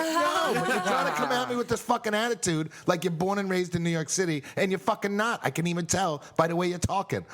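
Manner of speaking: high-pitched indignant comeback; retold heated argument. Man retelling how he challenged a woman in a bar, indignant. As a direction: re-enact arguing back, voice pushed up high.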